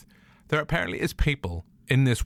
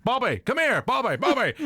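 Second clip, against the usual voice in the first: higher, sing-song call; calling Bobby; 12 semitones higher than usual. Pretend. Butler calling Bobby De Niro from across the room, higher call. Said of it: "where the voice is going".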